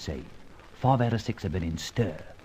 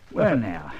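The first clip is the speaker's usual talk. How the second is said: Cockney chuckle; 5 semitones higher than usual. Acted. Charlie chuckles at the joke.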